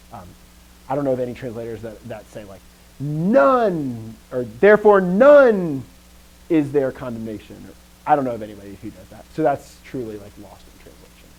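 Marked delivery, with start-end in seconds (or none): 3.0-4.1 "None" high, loud and held; big fronted stress on NONE; emphatic NONE. Shown as a demonstration. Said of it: "the emphasis of none". 4.4-7.6 NONE stretched high and loud; emphatic NONE. Shown as a demonstration. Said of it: "the emphasis of none".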